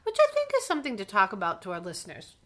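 ordinary talk, nothing marked

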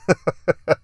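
short laugh at his own story; brief real laugh; amused laugh. Real, not acted. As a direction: chuckle fondly to yourself.

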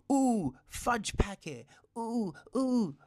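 high, slow, hammed-up fake pain noises; mocking bad acting. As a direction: parody a terrible actress getting tattooed. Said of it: "she's saying stupid things".

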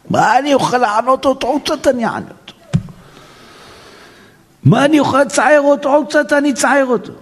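high, sneering inner voice; cruel spouse's thoughts. Retold or imitated. Rabbi voicing a spiteful spouse plotting, high sneer. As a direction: sneer like someone plotting to hurt.

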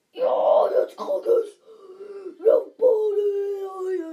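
loud strained yelling, breaking; frantic gamer yells. Real, not acted. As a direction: shriek as the kill lands. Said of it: "Ich mach nie so komische Geräusche".

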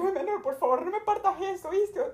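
high, squeaky mock begging; mock pleading. Traveller begs the agent not to snap her ID, high and pleading. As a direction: beg the agent in a high little voice.

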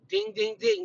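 high, ringing ding-ding-ding; imitated bell. Imitates a winning bell in delight.

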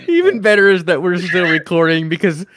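loud laughing while talking; laughing hard. Real, not acted. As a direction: laugh through every word. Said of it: "my stomach hurts on that one".

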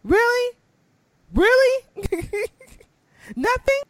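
high, rising disbelief, each "Really?" climbing; squeaky retold disbelief; incredulous disbelief. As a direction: act out mock outrage at being let down. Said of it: "I was like".

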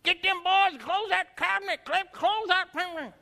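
high, lightly voiced grumpy old man; cranky grandfather. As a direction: nag Maydale about the cabinet. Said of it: "He'd go".

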